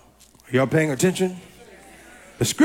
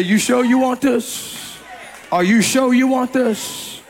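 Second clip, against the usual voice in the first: higher, drawn-out challenge, holding the last word; a raised, stretched-out warning question; challenging question; 6 semitones higher than usual. Acted. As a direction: ask it slowly, daring them to go on. Voicing the Lord's challenge.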